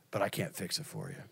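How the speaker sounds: very low, soft retold admission; gentle honesty. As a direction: quietly imitate a friend owning his limits. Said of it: "it wasn't condemnation".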